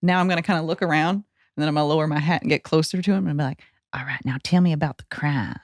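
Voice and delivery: ordinary talk, nothing marked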